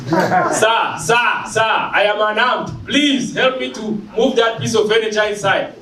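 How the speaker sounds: put-on African accent, urgent and high. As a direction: lay on a thick African accent, politely begging. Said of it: "I started to speak in African".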